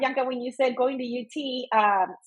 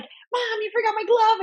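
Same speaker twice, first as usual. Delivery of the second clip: put-on high-pitched aggrieved child; whiny kid complaint; 12 semitones higher than usual. Mom imitating her son blaming her, high kid complaint.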